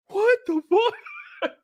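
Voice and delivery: very high, drawn-out disbelief with laughter; incredulous. Retold or imitated. Streamer reacting to absurd movie accents, incredulous. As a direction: squeal it slowly, half laughing in disbelief.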